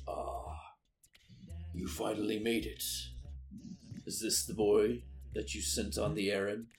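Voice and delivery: sinister stranger. Acted. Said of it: "He says".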